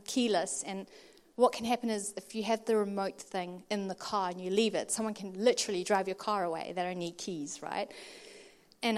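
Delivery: ordinary delivery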